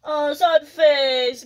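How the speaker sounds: slow, whimpery sad face; mock sad voice. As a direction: pretend to be sad for a toddler. Mother acts sad face for toddler.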